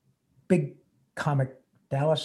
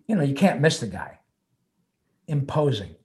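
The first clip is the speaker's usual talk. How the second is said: normal talking voice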